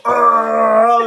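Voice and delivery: high, loud startled shout; loud mock exclamation. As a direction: blurt it out big. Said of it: "And I go".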